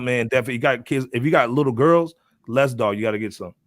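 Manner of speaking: ordinary delivery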